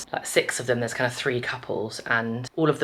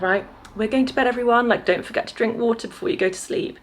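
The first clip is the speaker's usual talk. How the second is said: sensible grown-up; 10 semitones higher than usual. Retold or imitated. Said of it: "were like".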